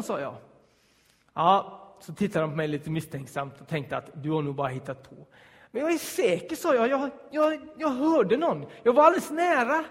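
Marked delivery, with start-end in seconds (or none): 5.7-9.8 insisting. Retold or imitated. Insisting to doubtful police.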